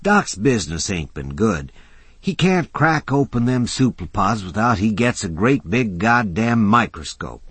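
lightly voiced tough guy; rough working man. Mac voiced by narrator.

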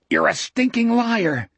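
high-pitched outburst of accusation; angry accusation. A narrator's reading. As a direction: throw the accusation in his face, voice jumping up. Jack angrily accuses the bully.

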